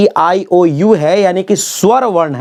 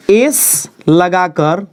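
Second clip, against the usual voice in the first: slow drawn-out emphasis; much slower than usual. Shown as a demonstration. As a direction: stretch एस लगाकर out very slowly, ending on a rise. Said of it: "केवल".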